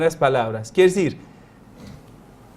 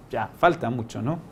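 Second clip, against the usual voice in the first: ordinary delivery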